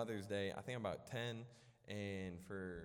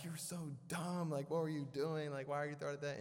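high-pitched, louder mock scolding; berating; 8 semitones higher, 3 dB louder than usual. Kid turns on his sister after the rock hit, berating her. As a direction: lay into her in a raised, exasperated voice. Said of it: "I start berating her".

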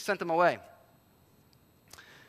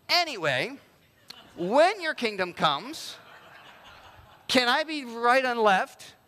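mock eager request; 3 semitones higher, 4 dB louder, slower than usual. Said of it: "James and John are like".

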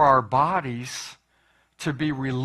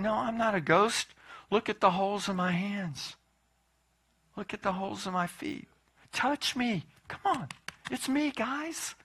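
softer, gently coaxing reassurance; calm the frightened friends softly; reassuring; 5 semitones higher, 6 dB quieter than usual. Retold or imitated. Jesus reassuring frightened disciples.

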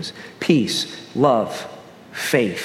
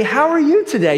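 high, bright mock greeting from a stranger; cheery stranger greeting; 11 semitones higher than usual. Preacher imitating a friendly stranger at the post office, high cheery greeting. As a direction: sing it out like an overly friendly clerk. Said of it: "for somebody randomly to say".

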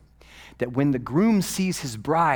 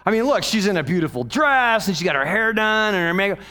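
louder, higher skeptical explaining voice; hammed-up know-it-all listener; mock objection; 7 semitones higher, 6 dB louder than usual. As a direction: argue the obvious reason, raised up. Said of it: "you say".

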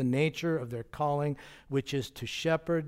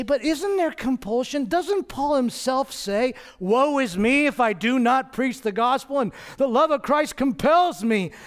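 higher, questioning objector voice; imagined objector; 9 semitones higher, 5 dB louder than usual. Retold or imitated. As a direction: voice the doubting listener. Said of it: "And you'll say".

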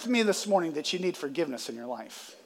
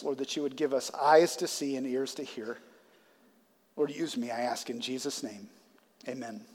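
prayer; 4 semitones lower than usual. Real, not acted. Pastor prays over the congregation, softening to a hush.

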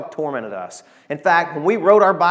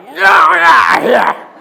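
a sudden, very loud, high shout chasing off a dog; father roaring at the Doberman to scare it away, full shout; dad yelling at dog; 12 semitones higher, 13 dB louder, much slower than usual. Retold or imitated. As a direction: bark it out like a dad driving off a mean dog. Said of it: "something like that".

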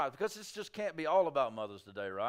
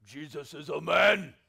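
mock macho dude; 5 semitones lower, 3 dB louder than usual. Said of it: "'Cause they're like".

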